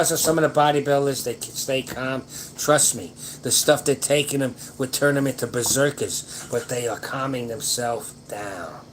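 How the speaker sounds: usual speaking voice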